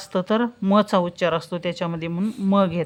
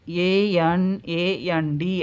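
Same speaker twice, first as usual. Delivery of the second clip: slow letter spelling; 4 dB quieter, much slower than usual. Shown as a demonstration. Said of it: "spelling letters aloud".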